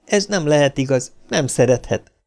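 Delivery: ordinary delivery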